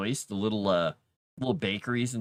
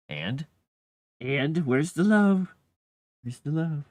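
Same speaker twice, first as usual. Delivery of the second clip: higher, louder, slow, demanding cat voice; voicing the cat; 6 semitones higher, 6 dB louder, slower than usual. Streamer voices her unimpressed cat, slow and demanding. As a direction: play the cat asking for affection, drawn out. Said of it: "He just stared at me like".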